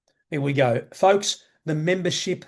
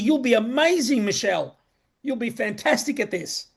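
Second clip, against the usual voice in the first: higher, gushing sales pitch, hammed-up; mock cheerleader; 6 semitones higher than usual. Mocking how recruiters flatter a newcomer, higher and gushing. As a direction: overpraise her like a hype recruiter. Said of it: "They tell you that this is easy".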